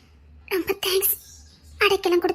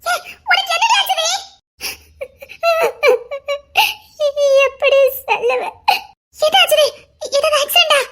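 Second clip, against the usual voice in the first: anxious pleading; 7 semitones higher than usual. Acted. Worried friend pressing for answers.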